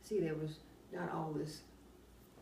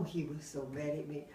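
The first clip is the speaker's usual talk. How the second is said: usual speaking voice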